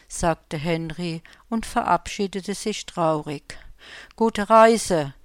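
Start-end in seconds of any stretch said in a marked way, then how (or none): 4.2-5.1 louder farewell call; calling after. Acted. The spruce calls after the departing snowman, loud farewell. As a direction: send him off with a call. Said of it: "rief ihm die Tanne noch nach".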